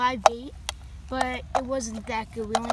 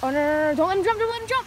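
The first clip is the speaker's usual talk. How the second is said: real urgent cry, climbing higher; urgent alarm; 7 semitones higher, 6 dB louder than usual. Real, not acted. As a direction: raise pitch and volume as the fish threatens to escape. Fish on the line jumping.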